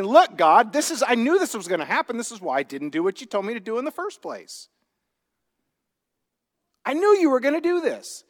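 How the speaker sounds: exasperated complaint to God, rising to a high cry; angry. Retold or imitated. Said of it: "And he's angry".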